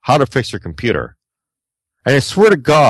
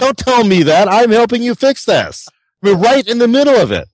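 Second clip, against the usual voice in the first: exasperated retort; 10 semitones higher than usual. Retold or imitated. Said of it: "You know, I said".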